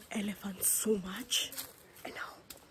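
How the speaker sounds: breathy hushed whisper. Real, not acted. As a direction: keep it to a whisper. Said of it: "And now I have to whisper".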